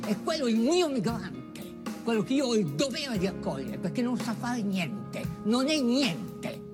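ordinary delivery

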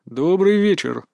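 put-on nonchalant greeting; deliberately casual. A narrator's reading. Disguised narrator greets the guard, feigned carelessness. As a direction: toss it off as someone else. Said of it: "стараясь говорить как можно более небрежно".

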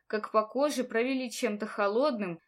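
usual speaking voice

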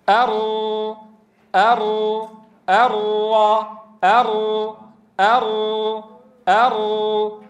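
أر repeated, each with one tap; single tap r drills. Shown as a demonstration. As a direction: tap each أر just once. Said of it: "أنا بدي وحدة من هي".

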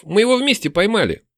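high-pitched protesting call; crying out. A narrator's reading. Elf claiming a shared catch, high protest. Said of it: "крикнул эльф".

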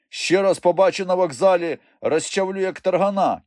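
high-pitched, menacing warning; angry threat. A narrator's reading. Stefan threatening the thief on the ground, angry. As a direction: threaten him, pitched well up.

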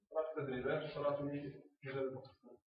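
ordinary talk, nothing marked

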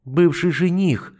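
high, slow indignant snap; huffy put-down; indignant correction. Acted. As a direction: correct him, offended. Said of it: "возмущённо поправила Матильда".

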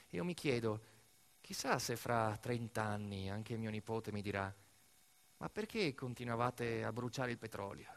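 soft, low, intimate storytelling; hushed reflection. A narrator's reading. Storyteller musing softly about his nephew's future questions. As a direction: draw the audience close and confide. Quiet reflective epilogue narration.

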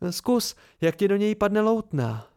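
can't wait for him to try it; excited. A narrator's reading. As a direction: Dena urging him eagerly. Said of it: "Dena se vzrušeně zasmála".

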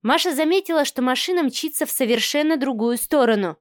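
ordinary delivery, nothing marked

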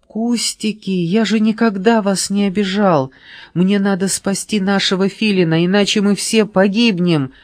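crying. A narrator's reading. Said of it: "плакал Шустрик".

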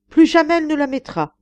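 loud, high, determined exclamation; resolute cry. A narrator's reading. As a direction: declare it as a lover who won't yield. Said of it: "s'écria l'amoureux d'un ton résolu".

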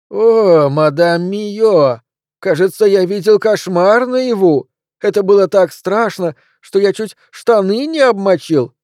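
high, loud, drawn-out mock-terrified squeal. A narrator's reading. Bully jeering along with his friends, mocking squeal. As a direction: pipe it up high in exaggerated mock fright. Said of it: "издевательски пропищал эти слова".

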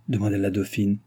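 plain reading voice

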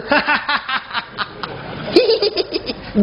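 high staccato giggling; put-on tittering in a high voice; mock happy laughter. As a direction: giggle absurdly, as if birth were fun. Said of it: "Pernah tengok mak beranak suka?".

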